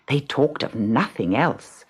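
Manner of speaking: plain reading voice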